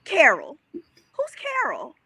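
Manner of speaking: slow, high-pitched who's asking; mock high confrontation. Girlfriend confronting cheating boyfriend, slow high mock question. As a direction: stretch it out, voice way up.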